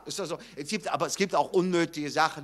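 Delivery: ordinary talk, nothing marked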